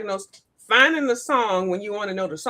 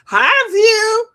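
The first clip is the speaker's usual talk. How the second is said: high, loud imitated hello; exaggerated high cheery hi; Joe; 12 semitones higher, 9 dB louder than usual. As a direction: sing out a happy greeting, mimicking him. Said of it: "he said hi to me".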